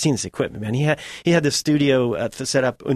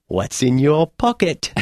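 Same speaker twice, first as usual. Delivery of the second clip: slow, a light English accent, imitating the director; a knowing question in a light English accent; 2 semitones higher, slower than usual. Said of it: "with his English accent".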